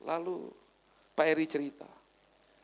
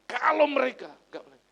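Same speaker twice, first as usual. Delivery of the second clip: sudden outburst; 8 semitones higher, 12 dB louder than usual. Real, not acted. Pastor heats up in sermon.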